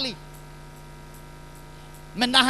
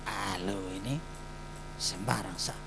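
low, hushed dismissal; low brush-off; 14 semitones lower, 15 dB quieter than usual. Acted. Peter brushes off the man who saw him in the garden, low and hushed. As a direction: wave him off quietly, nervously.

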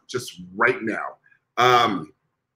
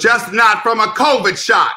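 shout; 7 semitones higher, 5 dB louder than usual. Real, not acted. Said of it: "punchline shouted".

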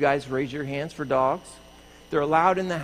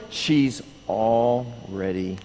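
very slow, lower, word by word; slow emphatic announcement; 4 semitones lower, much slower than usual. Acted. Host repeats the dog's credentials to everyone, slow and emphatic. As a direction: draw out each word deliberately.

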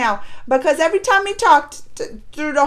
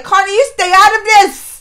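loud, raised-pitch telling-off; mad scolding; 4 semitones higher, 6 dB louder, faster than usual. Retold or imitated. Viewer telling Karna to keep out, loud mock scolding. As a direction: warn him off, annoyed and emphatic. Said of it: "I was mad at Karna too".

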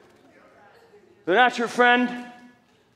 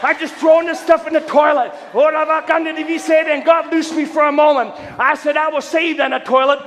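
loud, high revival preacher delivery; high-pitched, driving pulpit shout; full-force preaching; 8 semitones higher, 7 dB louder than usual. Real, not acted. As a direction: belt out the testimony like a fired-up preacher.

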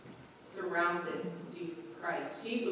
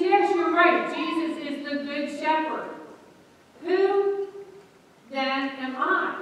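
put-on pastor to children; 7 semitones higher, 7 dB louder than usual. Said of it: "He says".

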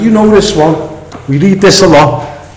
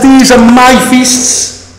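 high, slow stress on "my feasts"; emphatic declaration; 7 semitones higher, 3 dB louder, slower than usual. Acted. Preacher quoting God on whose feasts these are, emphatic.

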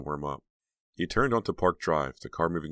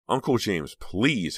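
higher-pitched, mildly angry protest; angry plea; 6 semitones higher, 4 dB louder, much slower than usual. A narrator's reading. Niece to prying uncle, angry plea. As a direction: cry out to make him stop. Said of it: "Heather cried angrily".

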